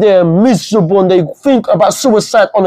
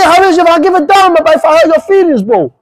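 defiant declaration; 8 semitones higher than usual. Real, not acted. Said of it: "I say".

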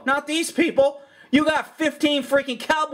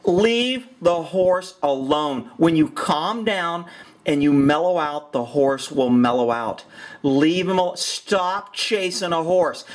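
calm and slow; 6 semitones lower, slower than usual. Real, not acted. Calming advice about a spooked horse.

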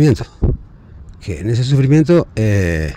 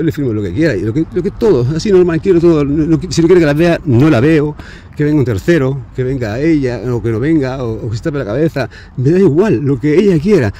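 más alto y agudo, alterado; desesperación alterada; 5 semitones higher, 3 dB louder than usual. Real, not acted. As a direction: suplicando al borde del grito. (exasperado y alzando la voz)

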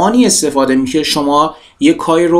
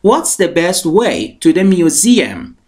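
slow model reading; 3 semitones higher, much slower than usual. Shown as a demonstration. Teacher models English sentence.

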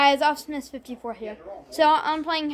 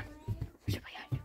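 slow hushed whisper; whispering while hiding; 16 dB quieter than usual. Real, not acted. Hiding during hide and seek.

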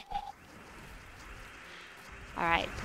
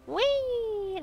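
very high squeal of joy; gleeful whee. Real, not acted. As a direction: squeal like on a slide. Excited cheer.

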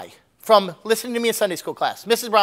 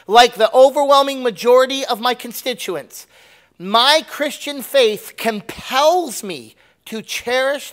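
raised, deliberate public declaration; emphatic quote; 4 semitones higher, 6 dB louder, slower than usual. Acted. Quoting a candidate's statement on Israel to the congregation, emphatic quote. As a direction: declare it firmly, higher, drawing out the key words. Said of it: "this is a person saying this".

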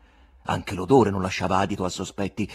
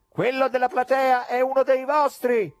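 heckler from the gallery; 14 semitones higher, 5 dB louder than usual. A narrator's reading. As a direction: jeer down from the balcony. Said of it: "si udì dal loggione".

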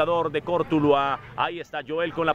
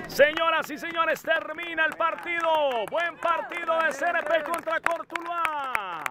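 announcer; 9 semitones higher than usual. Real, not acted. Full-time announcement.

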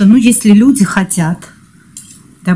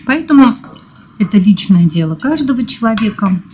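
ordinary delivery

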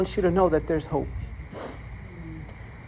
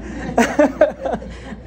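laugh; 8 semitones higher than usual. Real, not acted. Laughing at own reluctance.

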